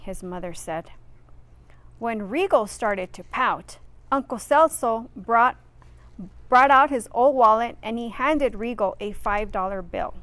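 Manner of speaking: plain reading voice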